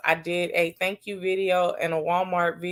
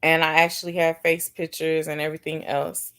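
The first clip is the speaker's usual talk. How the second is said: usual speaking voice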